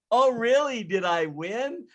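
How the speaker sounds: mock girlish surprise; hair-tossing lucky girl. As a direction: play the oblivious winner, drawing out the question. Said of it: "they'd toss their hair and go".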